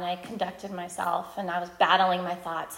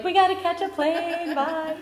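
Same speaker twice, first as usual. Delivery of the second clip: singsong hurry-off; 8 semitones higher than usual. Retold or imitated. Rushing off to the airport.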